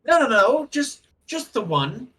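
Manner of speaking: wizard protesting. Acted. Wizard corrects the cleric.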